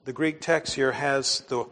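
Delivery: normal talking voice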